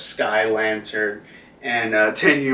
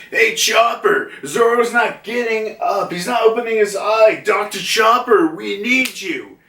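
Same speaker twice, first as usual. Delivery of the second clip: higher, urgent mock shout for the doctor; urgent call; 9 semitones higher than usual. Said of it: "it's like".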